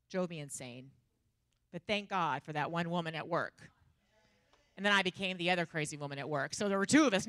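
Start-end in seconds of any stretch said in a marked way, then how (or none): none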